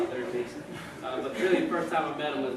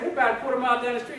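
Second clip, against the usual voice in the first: ordinary talk, nothing marked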